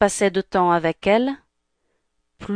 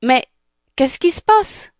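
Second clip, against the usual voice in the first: high-pitched puzzled question; bewildered; 6 semitones higher than usual. Acted. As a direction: ask it in startled confusion. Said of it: "qui ne comprenait pas". (incompréhension, ton aigu)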